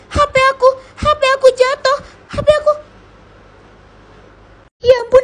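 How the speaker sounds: high, loud, frantic panic over the dropped phone; terrified the phone with the proof is broken; panicking. Acted. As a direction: rush the words out in alarm. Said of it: "Kenapa sih nih anak panik banget".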